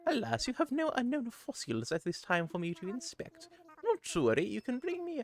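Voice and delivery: high, fussy owl curator voice; a chirpy, proper museum owl; Blathers voice. Acted. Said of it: "the fun Blathers voice about assessing your fossils".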